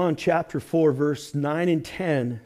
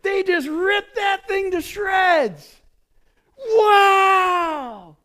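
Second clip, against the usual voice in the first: high, loud, slow excited shout; loud thrilled whoop; excited kids; 5 dB louder, much slower than usual. Retold or imitated. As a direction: act out kids tearing into gifts. Acting kids tearing gifts.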